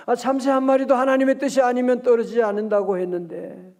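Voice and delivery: ordinary talk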